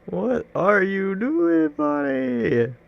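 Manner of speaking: high, slow, sing-song taunt. Acted. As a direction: tease the opponent slowly. Taunts the chess opponent.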